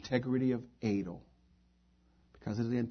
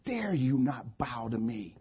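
furious king; 3 semitones higher, 6 dB louder than usual. Acted. King threatens those who would not bow, furious. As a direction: start restrained, swell into loud commands. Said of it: "He was furious".

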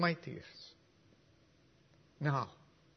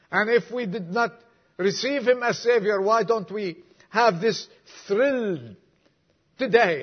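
louder, higher, ringing preacher's cry; full-force preaching; 5 semitones higher, 6 dB louder than usual. Acted. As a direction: preach it out to the whole church.

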